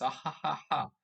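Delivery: recited, put-on laughter; imitated laugh. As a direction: fake a short chain of ha-ha-has. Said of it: "they're laughing at that".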